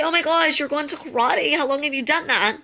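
high, bright eager gush; excited demo reply. Shown as a demonstration. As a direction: sound thrilled to ask back. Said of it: "If you say".

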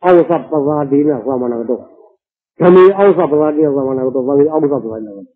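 measured, lightly intoned Pali verse; Pali recitation. Acted. Recites Pali verse before explaining. (ပါဠိဂါထာကို ညင်ညင်သာသာ ရွတ်ဆိုသံ)

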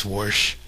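"warsh" with an r slipped in, like grandma; "warsh" with a folksy r; grandma's warsh. Retold or imitated. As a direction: say "warsh" with an added r before the sh. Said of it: "my grandma says".

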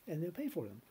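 usual speaking voice

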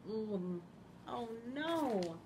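plain reading voice

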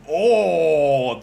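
loud gasp of surprise; sudden loud oh of wonder; loud surprised oh. Real, not acted. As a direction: bark an amazed oh. Sees new drawing.

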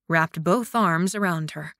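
usual speaking voice

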